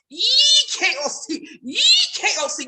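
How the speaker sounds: very slow drawn-out E K O C; real good and slow. Shown as a demonstration. To feel involved in service, very slow. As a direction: stretch every letter. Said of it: "say it real good and slow".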